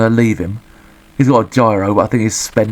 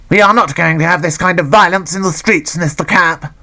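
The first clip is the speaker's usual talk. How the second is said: higher, pompous magistrate lecturing the accused; stern judge; 7 semitones higher than usual. Acted. Judge scolding Andy Capp in court, mock-pompous. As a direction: play a pompous judge, lightly voiced. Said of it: "said the judge".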